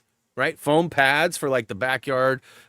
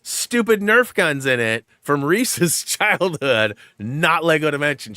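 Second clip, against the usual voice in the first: laughing exasperated retort; 5 semitones higher, 8 dB louder than usual. Retold or imitated. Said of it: "I go".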